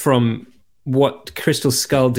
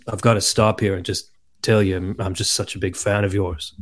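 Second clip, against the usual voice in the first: low, softer imitation of a film star; Harrison Ford; 5 semitones lower, 5 dB quieter than usual. Film star telling an actor he's a fan, low imitation. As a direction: quietly confess admiration in a lower voice. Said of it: "Harrison Ford just goes".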